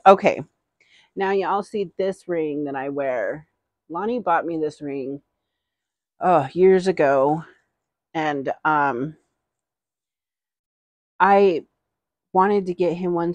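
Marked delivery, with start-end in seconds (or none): none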